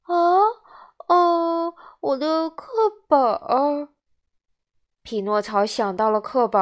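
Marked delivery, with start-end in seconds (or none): 0.1-3.9 put-on high, hesitant child voice; caught-out little boy fumbling for words; flustered puppet boy. A narrator's reading. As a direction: stall guiltily with drawn-out sounds. Pinocchio caught out about textbook.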